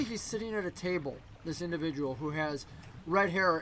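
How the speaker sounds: usual speaking voice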